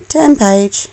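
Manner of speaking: ordinary delivery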